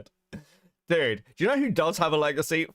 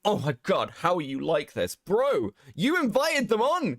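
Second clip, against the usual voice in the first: exasperated mock Caleb, rising higher; imitating Caleb; 6 semitones higher than usual. Mocking Caleb scolding guests in his shorts, exasperated higher mock voice. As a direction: ham up his fake outrage at the guest. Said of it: "All the shorts are Caleb going".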